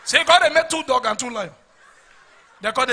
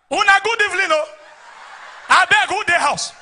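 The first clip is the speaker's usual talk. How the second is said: high, loud call to the house; 7 semitones higher, 3 dB louder than usual. Acted. Armed robber boss greeting the house from outside, calling out. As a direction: call out a polite greeting across the yard.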